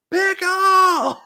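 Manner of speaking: put-on high, loud kid's yell of pickle; shouting to the whole field; Sandlot shout. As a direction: holler it like a kid on the sandlot.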